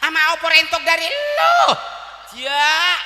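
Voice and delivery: high, loud mock-scolding yell; exasperated shout. Mother answers children asking what food there is, exasperated shouting. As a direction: yell it back as a comic telling-off.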